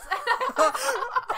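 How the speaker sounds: high, very loud shriek of laughter; laughing. Real, not acted. Friends hearing the punchline, loud high laughter. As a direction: explode into real laughter.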